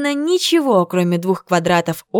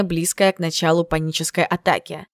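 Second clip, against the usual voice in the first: ordinary delivery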